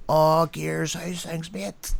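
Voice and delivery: schoolboy greeting. Retold or imitated. Host imitating another schoolmate greeting him by nickname, soft matey voice. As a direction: lightly voice a mate greeting. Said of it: "schoolboy nickname".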